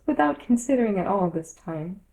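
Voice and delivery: usual speaking voice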